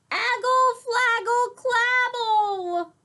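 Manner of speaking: put-on high, loud baby babbling; squeaky toddler gibberish, insistent; toddler babble.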